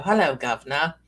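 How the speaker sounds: hammed-up British greeting; mock British accent. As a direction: put on a playful Cockney-style British accent. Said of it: "I'm all about the accents".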